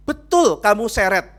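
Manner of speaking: loud, high-pitched accusing question; acted interrogation. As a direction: fire the accusation at full volume. Acted pastor interrogates the husband.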